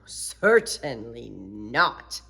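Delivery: low, slow firm refusal; deep stern fireman; big fireman. Acted. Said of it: "said the fireman".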